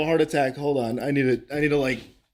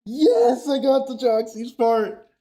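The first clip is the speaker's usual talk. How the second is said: excited; 7 semitones higher, 4 dB louder, slower than usual. Real, not acted. Clears a hard game section.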